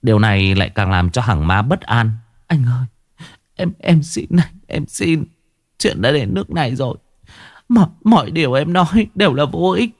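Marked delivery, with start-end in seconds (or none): 2.5-9.9 much higher, stammering desperate plea; wife begging husband to spare her lover, frantic; frantic pleading wife. A narrator's reading. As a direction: beg for the lover's life, voice rising.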